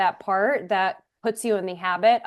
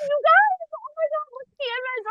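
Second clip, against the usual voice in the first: very high, gushing; excited squeal. Real, not acted. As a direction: squeal at the picture. Excited about the generated image.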